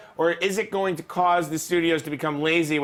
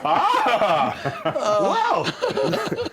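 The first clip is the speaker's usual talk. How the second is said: high laughing whoa; surprised laughing exclamation; delighted surprise; 9 semitones higher, 3 dB louder than usual. Real, not acted. Delighted at twist.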